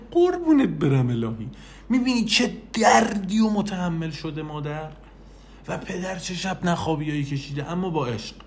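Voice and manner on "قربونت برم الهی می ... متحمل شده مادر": put-on loving coo to a baby. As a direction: coo over a newborn. Cooing to a baby.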